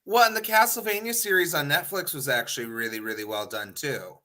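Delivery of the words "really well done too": ordinary talk, nothing marked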